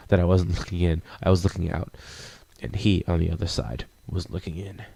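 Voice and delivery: low, measured poetic recitation; poem reading. Acted. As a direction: read it as a brooding, solemn poem.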